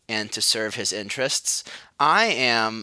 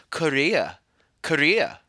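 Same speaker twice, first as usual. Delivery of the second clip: British accent attempt; 5 semitones higher, 5 dB louder than usual. Shown as a demonstration. Trying to show how the British say Korea, accent slipping. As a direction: say Korea as kʰə˞iə, aiming for British. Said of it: "like a bad stereotype of a Chinese man".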